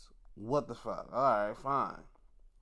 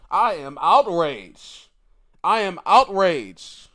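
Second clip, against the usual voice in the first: slow, much louder hammed-up outrage; mock outrage; 3 semitones higher, 12 dB louder, slower than usual. Streamer hamming up anger at Logan Paul's booking, mock outrage. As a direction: play up fake fury for the camera. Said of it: "Not really that outraged".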